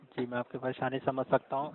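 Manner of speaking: normal talking voice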